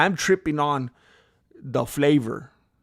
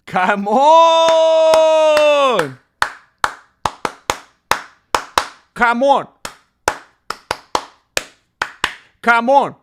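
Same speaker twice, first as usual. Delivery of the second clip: loud, high come on; hype shout; 10 semitones higher, 11 dB louder, much slower than usual. Real, not acted. Streamer hyping up viewers at the start, loud. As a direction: pump up the crowd.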